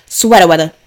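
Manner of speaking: hammed-up skit voice, dropped r's; SNL skit impression. Quoting a comedy skit to listeners, mock catchphrase voice. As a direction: playfully mimic the skit's catchphrase. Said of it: "That's how they say it".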